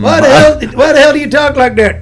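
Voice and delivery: indignant Southern character. Acted. Comedian as one film character questioning the other, loud mock indignation. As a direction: challenge him loudly in character.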